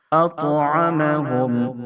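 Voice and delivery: high, slow melodic chant; tajweed recitation, high and drawn out; Quranic recitation. Acted. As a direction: recite slowly with a sung, held melody. Recited model word.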